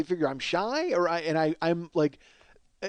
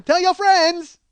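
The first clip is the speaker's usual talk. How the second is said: high, loud mock parlor woman; gleeful hollered catchphrase; called-out farewell; 11 semitones higher, 9 dB louder, much slower than usual. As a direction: belt it out as an imitated send-off. Repeats woman's farewell as a shout.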